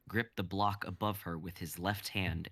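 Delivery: usual speaking voice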